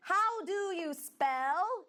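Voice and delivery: very slow, high "How do you spell?"; teacher modelling "How do you spell?" very slowly; slow model phrase. Shown as a demonstration. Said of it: "Então, vamos lá".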